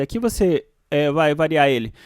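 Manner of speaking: usual speaking voice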